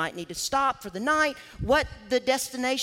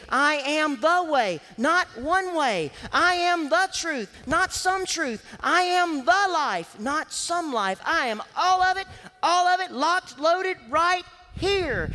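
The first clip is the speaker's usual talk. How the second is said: "I" and "not" hit hard, higher and louder, slow; emphatic declaration; 8 semitones higher, 5 dB louder, slower than usual. Shown as a demonstration. Preacher voicing Jesus stating his divinity, emphatic and loud. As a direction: proclaim it, I and no other. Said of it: "is emphatic, meaning that I and no other".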